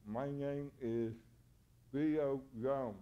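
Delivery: slow, careful tongue-tied speech; holding tongue with fingers. Shown as a demonstration. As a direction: say it with your tongue held down. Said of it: "how to speak like this".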